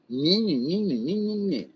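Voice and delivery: sing-song mocking meh-meh; childish mock chatter. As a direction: mimic their nagging as nonsense babble.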